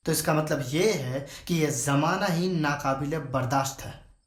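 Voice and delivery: normal talking voice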